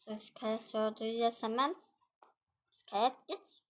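ordinary talk, nothing marked

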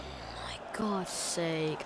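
breathy whisper under the breath, slowed; exasperated whispered curse at the screen; frustrated whisper. Real, not acted. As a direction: hiss it out in disbelief, barely voiced. Muttered in frustration at teammate.

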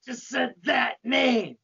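mock-furious Satan, slow at the end; hammed-up outraged yell; angry yell. A narrator's reading. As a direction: roar it as an outraged devil. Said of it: "He angrily yells".

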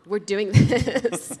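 slow and laughing as you say it. Real, not acted. Laughs while declaring boldness.

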